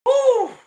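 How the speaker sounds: high, loud blowing-out exhale; exasperated whew. Real, not acted. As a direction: blow out the tension. Exhaling after the rant.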